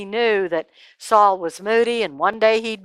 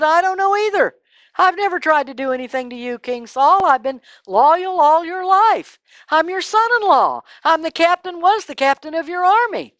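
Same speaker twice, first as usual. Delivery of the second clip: higher, pleading protest; earnest David; 7 semitones higher, 3 dB louder than usual. Acted. David protesting his loyalty to Saul, earnest and raised. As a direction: insist on your loyalty. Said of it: "David said".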